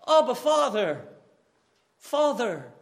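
loud, pleading cry of Abba, Father; crying out to God. Pretend. Believer crying out to God, loud pleading call. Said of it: "I cry out to him".

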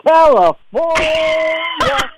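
high, drawn-out announcer holler; big hollered opening; shouted show intro. Acted. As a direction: belt it out, stretching the last word.

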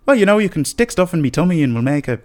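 playful Irish impression edging into laughter; Irish accent. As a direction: improvise as an Irish character, amused. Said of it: "an Irish accent and a Mancunian".

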